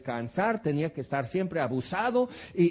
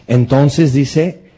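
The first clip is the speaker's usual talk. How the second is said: booming slow preacher cry; loud outburst; 3 semitones lower, 15 dB louder, much slower than usual. Real, not acted. Preacher booming to his congregation, slow and loud. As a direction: bellow it out slowly to the church.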